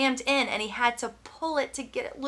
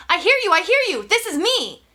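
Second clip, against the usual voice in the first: loud, much higher, relieved and urgent; eager shout; 8 semitones higher, 8 dB louder than usual. Acted. Brian answering the radio voice, eager loud relief. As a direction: blurt it out, desperate to be heard.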